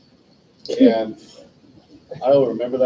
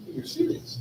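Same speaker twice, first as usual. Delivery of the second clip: breathless, whispered "You're serious?" pitched up high; stunned disbelief; 8 dB quieter than usual. Retold or imitated. Retelling his reaction when his best friend came out, hushed stunned disbelief. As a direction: gasp it out in quiet shock.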